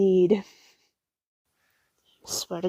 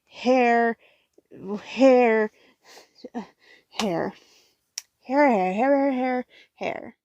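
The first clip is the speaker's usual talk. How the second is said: mock obsessive repeating; 2 semitones higher, much slower than usual. Mock obsessive repetition of hair.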